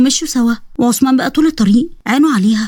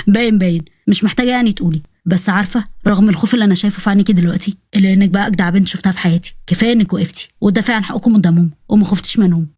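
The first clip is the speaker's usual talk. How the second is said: low, fond male voice; Osman, teasing admirer; 4 semitones lower than usual. Acted. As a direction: tease her gently, then praise her in a lowered voice.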